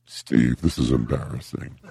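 ordinary talk, nothing marked

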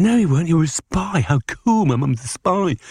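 high, gleeful protest; thrilled to bits, like a child with a secret; excited boyish delight; delighted excitement. Retold or imitated. Delighted at mum being spy.

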